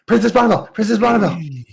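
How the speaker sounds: calling out. Acted. Kids calling the princess.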